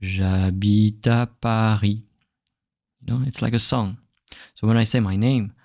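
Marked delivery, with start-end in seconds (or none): 0.0-2.0 slow J'habite à Paris with no gaps; unbroken flow. Shown as a demonstration. Teacher repeating the linked sentence slowly. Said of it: "My voice doesn't stop".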